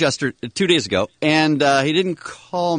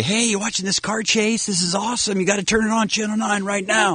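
higher-pitched, eager friend on the phone; excited caller; 8 semitones higher than usual. Retold or imitated. Friend phoning about a live car chase, excited. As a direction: urge him to tune in, pitched up. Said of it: "Lightning will call me and say".

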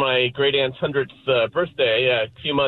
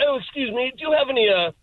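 bad English accent; 9 semitones higher than usual. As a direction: order in Italian like a stuffy English gent. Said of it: "in Italian with a bad English accent".

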